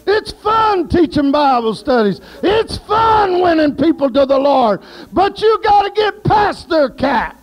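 high, shouted praise, slowing at times; preacher exulting about Bible study; preaching at full force. Real, not acted. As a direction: belt it out like a revival preacher.

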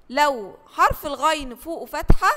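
ordinary talk

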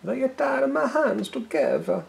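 telling the punchline as the Italian spy; Italian accent. Retold or imitated. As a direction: do the Italian spy from the joke, hammed up. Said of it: "He just says".

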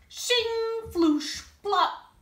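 high, loud shovel sound effects; digging noises. Acted. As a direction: voice the spoon digging with playful noises.

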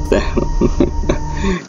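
high-pitched burst of laughter; nervous giggle. Real, not acted. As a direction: giggle at the sudden loudness. Jumpy laugh at loud game audio.

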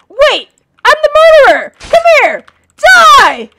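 high, loud hammed-up killer shouts; mock murderer yell. Pretending to be the murderer, hammed-up yelling. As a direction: roar like a fake murderer. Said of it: "I'm just kidding".

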